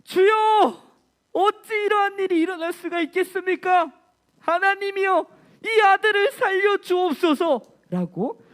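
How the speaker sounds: high, loud desperate plea; crying out in prayer. Acted. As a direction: cry out to God, high and loud. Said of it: "여호와께 부르짖으며".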